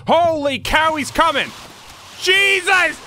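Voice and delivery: sudden high frightened yell; jump-scare shriek, high and loud; startled shout. Real, not acted. Startled by monster in horror game.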